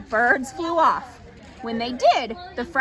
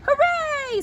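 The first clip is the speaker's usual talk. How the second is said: high joyful Piglet cheer; 13 semitones higher than usual. A narrator's reading. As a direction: squeal out a happy hooray. Plan worked, Piglet cheers.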